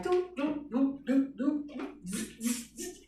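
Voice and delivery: vocalize a club beat, doops then buzzes; imitated rave beat. Recalling the New Year's rave, mouthed dance beat.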